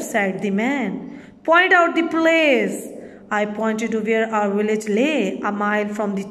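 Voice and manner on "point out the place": high, loud command; barked order. A narrator's reading. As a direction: bark the order at him. Convict orders the boy.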